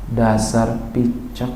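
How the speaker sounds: soft, low, very slow insult; deceptively gentle. Shown as a demonstration. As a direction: deliver the insult as if tenderly, drawn out and hushed. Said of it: "Jadi kelihatan lembut". (makian dengan nada lembut dan pelan)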